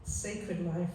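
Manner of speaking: quiet heartfelt recitation; tender poem reading. Acted. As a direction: gently finish the love poem, each line savoured. Resumes the poem after tears.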